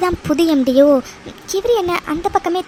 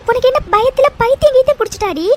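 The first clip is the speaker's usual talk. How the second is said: higher, exasperated telling-off; exasperated scolding; 5 semitones higher, 4 dB louder than usual. Acted. Friend scoffing at friend's fearful theory, exasperated.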